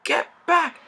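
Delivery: high, loud warning cry; cried out. A narrator's reading. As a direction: shout the warning in alarm. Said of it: "everyone cried".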